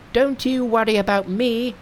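high, bright-pitched monkey king. Acted. Narrator voicing Monkey to his master, high monkey voice. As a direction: play the cocky monkey hero up high.

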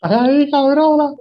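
slow, high, loud outraged drawl; audience yelling. Retold or imitated. Cinema crowd yelling at the girlfriend, slow and high. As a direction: let the audience's outrage stretch out.

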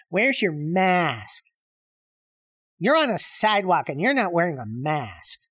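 drawn-out, slow mock nag of a stranger. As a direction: stretch out "Where's your mask" like a preachy busybody. Mocking a stranger demanding masks.

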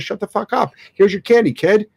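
a worn-out parent giving in with a snap; fed-up parent. Retold or imitated. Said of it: "until the parent goes".

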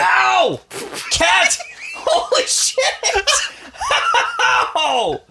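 high startled yelps and curses; real pained yelling. Real, not acted. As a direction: yell at a cat clawing you. Cat claws him, real cries of pain.

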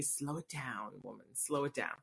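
low, soft scolding to herself; quiet self-scolding. Retold or imitated. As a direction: murmur the reminder softly, shaking a head at herself. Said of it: "it was a reminder to me".

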